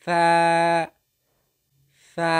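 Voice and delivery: সা as Arabic tha, tongue tip at upper teeth; sustained letter-drill সা with tongue on teeth; demonstrated letter sound. Shown as a demonstration. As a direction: model a long, level সা. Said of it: "উপরে দুই দাঁতের সঙ্গে লাগে".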